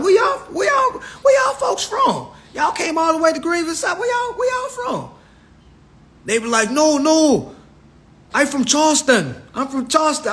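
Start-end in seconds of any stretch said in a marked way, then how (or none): 0.0-5.1 very high-pitched, baffled questioning; incredulous questioning. Retold or imitated. Newcomer grilling teammates on where their folks are from, incredulous. 6.7-10.3 high-pitched mock Geechee accent; thick Charleston accent. Charleston teammates insisting on their hometown, thick Geechee accent. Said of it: "they had the thickest accent".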